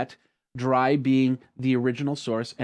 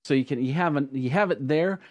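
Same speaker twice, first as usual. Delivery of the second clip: normal talking voice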